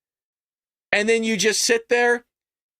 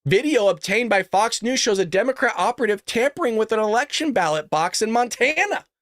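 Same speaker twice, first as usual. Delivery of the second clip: ordinary talk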